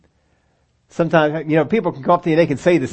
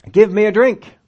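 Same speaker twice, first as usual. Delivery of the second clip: higher, louder, curt demand; blunt command; 6 semitones higher, 6 dB louder than usual. Retold or imitated. Preacher voicing Jesus asking the woman for water, louder and blunt. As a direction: ask with no please. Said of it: "Jesus says".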